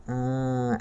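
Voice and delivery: ordinary talk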